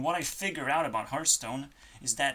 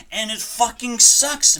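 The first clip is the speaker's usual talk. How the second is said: "sucks" belted higher and louder; frustrated yell; 7 semitones higher, 9 dB louder than usual. Real, not acted. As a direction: shout "it fucking sucks" in real frustration. Repeats line, first louder.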